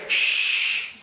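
long hushing "shh"; shushing. Acted. Preacher acts Jesus hushing those he healed, long shush. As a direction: hush the crowd with a drawn-out shh. Acting out Jesus telling everybody to keep quiet.

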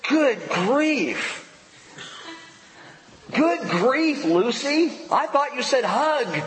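high, exasperated cartoon kid; high whiny Good grief, Lucy; Charlie Brown voice. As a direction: wail it like a flustered boy. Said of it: "And then he says".

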